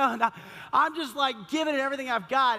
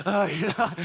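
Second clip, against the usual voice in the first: acted exhaustion; 5 semitones lower than usual. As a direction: play a spent runner struggling on.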